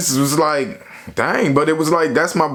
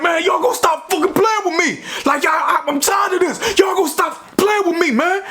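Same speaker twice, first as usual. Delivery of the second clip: flipping out; 13 semitones higher than usual. Retold or imitated. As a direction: yell it like a friend losing his temper over jail food. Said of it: "he just flipped out".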